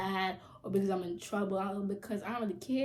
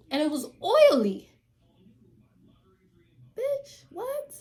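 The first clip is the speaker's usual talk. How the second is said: loud then very high disbelief; mock disgust; 9 semitones higher, 7 dB louder, slower than usual. Real, not acted. Telling viewers about the girl's hair, hammed-up disgust. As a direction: sell the outrage at the hair.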